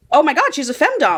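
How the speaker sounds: high, thrilled exclamation; excited. Retold or imitated. Reader finds out the twist, excited. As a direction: burst out with delighted discovery. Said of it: "I got really excited".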